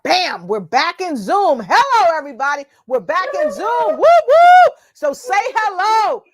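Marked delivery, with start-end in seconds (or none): none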